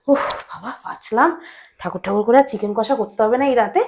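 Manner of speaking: soft, low relieved aside; relieved muttering. Acted.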